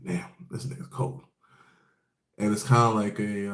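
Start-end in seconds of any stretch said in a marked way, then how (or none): 0.0-1.2 breathy whispered aside; hushed aside about a cold-hearted mentor; whispered reaction. Retold or imitated. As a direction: whisper it like you're shocked. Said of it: "I was like".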